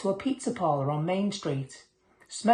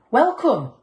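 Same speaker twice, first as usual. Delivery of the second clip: loud hearty welcome; 7 semitones higher, 8 dB louder than usual. A narrator's reading. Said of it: "he said".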